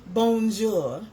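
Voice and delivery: loud bonjour in a mock French accent; French greeting. Shown as a demonstration. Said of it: "That's my French".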